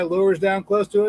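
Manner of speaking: ordinary talk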